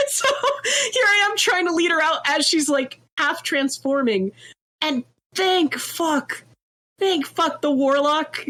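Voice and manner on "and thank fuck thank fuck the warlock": slow, pitched-up thank fuck; high drawn-out relief. Retold or imitated. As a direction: stretch it out in giddy relief. Relief at the warlock.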